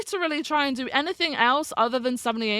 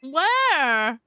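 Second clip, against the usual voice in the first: louder, higher shout; yelling across the room; 4 semitones higher, 5 dB louder than usual. Real, not acted. Calling out for the bike.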